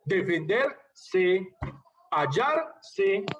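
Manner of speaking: slow "Defenderse", pausing before the "se"; "hallarse" in two pieces, hallar... se; final -se split off. Shown as a demonstration. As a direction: set the "se" apart in "Defenderse" and "hallarse". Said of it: "la última sílaba es con S".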